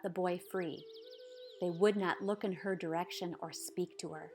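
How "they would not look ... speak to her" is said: ordinary delivery, nothing marked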